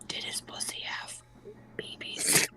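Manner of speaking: breathless cheeky whisper; whispered joke. Real, not acted. Friend sneaks a rude joke to the group, whispering. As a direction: whisper it like a naughty secret. Naughty joke aside in chat.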